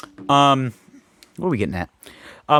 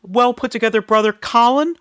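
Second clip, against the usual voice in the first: much higher put-on voice; high-pitched name-dropping son of a famous dad; coattail-riding rich son; 13 semitones higher, 4 dB louder than usual. Famous actor's son bragging about his family, mock high voice.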